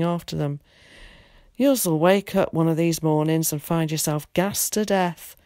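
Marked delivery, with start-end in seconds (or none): none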